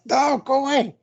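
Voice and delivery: high, loud brush-off; mock outburst. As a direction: shoo them off in a burst. Retold character shooing someone away.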